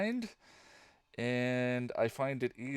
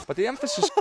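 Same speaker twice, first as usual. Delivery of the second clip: laughing; 10 dB louder, faster than usual. Real, not acted. Starts the line, breaks into laughter.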